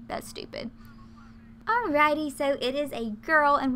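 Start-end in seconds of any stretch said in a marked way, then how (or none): 0.1-0.7 whispered aside. Real, not acted. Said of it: "muttered aside".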